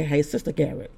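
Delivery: low, soft offhand greeting; understated brush-off hello; dismissive greeting. Retold or imitated. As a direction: toss it off quietly, with little regard. Retold greeting withholding her title.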